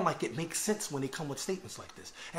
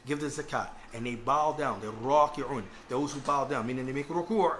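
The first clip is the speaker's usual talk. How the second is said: ordinary talk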